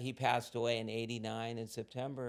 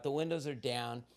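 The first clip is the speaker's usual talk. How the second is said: normal talking voice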